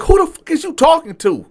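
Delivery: high, loud furious demand; angry challenge. Retold or imitated. Diner squaring up on the waiter, high and furious. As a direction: get in his face with it.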